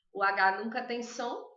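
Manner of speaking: normal talking voice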